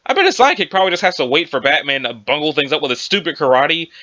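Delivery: ordinary delivery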